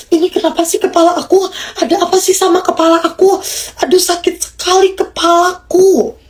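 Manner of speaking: put-on high, fast complaining toddler voice; whiny child. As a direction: act out a child whining about a headache. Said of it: "Itu yang sedang dia katakan".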